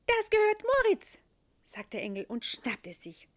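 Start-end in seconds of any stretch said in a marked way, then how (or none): 0.1-1.2 high, loud little angel; a bright high angel. A narrator's reading. Angel character.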